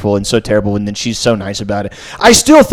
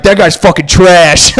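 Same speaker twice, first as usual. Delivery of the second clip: loud mock bro trash talk; imitating a boorish sports fan, drawn out; bro brain; 5 semitones higher, 8 dB louder, slower than usual. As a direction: heckle like a bro, slow and loud. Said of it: "I just got bro brain".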